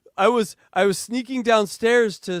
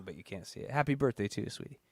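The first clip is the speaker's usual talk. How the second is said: soft flirty; 7 semitones lower, 11 dB quieter than usual. Real, not acted. Flirty aside to Wendy.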